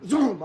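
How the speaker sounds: loud high whoosh sound; shouted whoosh. Acted. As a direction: blast the zoom sound. Sound effect shouted at rival.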